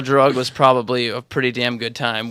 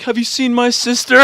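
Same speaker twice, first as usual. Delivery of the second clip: high mock voice; 8 semitones higher than usual. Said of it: "be like".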